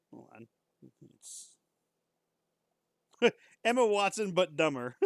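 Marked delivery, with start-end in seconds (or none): 3.2-5.1 laughing through the name; can't help laughing at it. Real, not acted.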